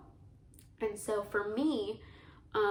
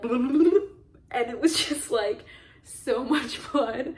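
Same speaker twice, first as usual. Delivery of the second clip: laughing much louder, slow and drawn out; disbelieving laugh; 3 semitones higher, 11 dB louder, much slower than usual. Real, not acted. Recalling the sound of her blood hitting the floor, laughing loudly. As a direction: laugh through it in stunned disbelief.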